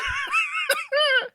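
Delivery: high shrieking burst of laughter. Real, not acted.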